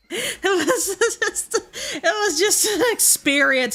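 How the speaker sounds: high, helpless giggly speech; laughing while talking. Real, not acted. Streamer recalling the toad chaos, laughing through words.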